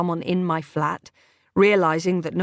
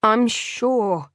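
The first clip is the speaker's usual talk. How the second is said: through gritted teeth; 3 semitones higher than usual. A narrator's reading. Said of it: "My teeth were gritted with fury".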